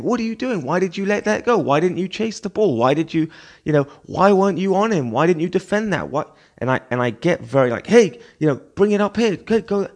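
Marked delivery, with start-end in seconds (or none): 0.0-6.3 retold shouting. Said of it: "like, shouting and saying". 7.9-9.9 calling out. Retold or imitated. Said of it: "the most vocal person on the pitch".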